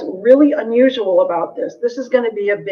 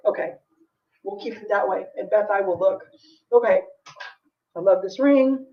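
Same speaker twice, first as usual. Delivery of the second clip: ordinary talk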